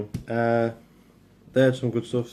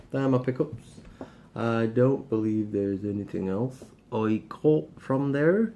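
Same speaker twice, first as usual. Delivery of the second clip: put-on light Cornish accent. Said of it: "I'm talking in a Cornish accent".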